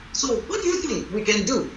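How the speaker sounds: ordinary talk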